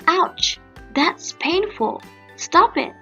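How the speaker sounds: mild, high-pitched cry of pain; pained outcry. Acted. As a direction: yelp in pain without much extra volume. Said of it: "she cried loudly".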